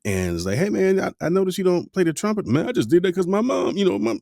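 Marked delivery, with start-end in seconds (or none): 0.6-2.5 high, louder, quick mimicked buddy; imitated friend. Asking a former bandmate about the trumpet, high imitated friend. 2.5-4.2 high, quick mock-protesting friend; imitated excuse. Ex-trumpet player blaming his mom, high imitated protest.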